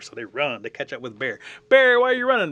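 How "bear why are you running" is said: very high, loud shouted question; urgent storyteller's holler; rabbit calling out. Acted. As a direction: yell it after someone running. Rabbit calls out to bear.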